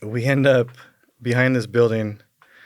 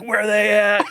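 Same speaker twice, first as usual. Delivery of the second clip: wild demand; 10 semitones higher, 5 dB louder than usual. Retold or imitated. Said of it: "I'm like".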